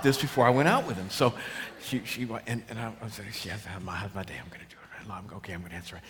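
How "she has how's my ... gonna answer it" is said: very soft and low, nearly whispered; muttered self-coaching. Acted. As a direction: rehearse it under your breath. Rehearsing nervously to himself before answering.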